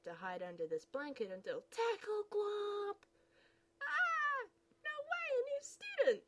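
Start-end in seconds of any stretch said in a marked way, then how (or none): 3.8-6.2 very high, louder, thrilled; excited squeal. Acted. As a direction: burst out with delight at the newcomer. Excited girl spots newcomer.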